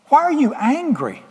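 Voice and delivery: high, sing-song question from the father; mock father's question. Father to older son, asking why he's angry, in a lifted, puzzled voice.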